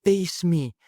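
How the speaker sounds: high, loud mock yell; Wonder Woman yelling. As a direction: yell it out like a challenge. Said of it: "Wonder Woman's just yells".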